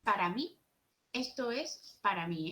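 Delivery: ordinary talk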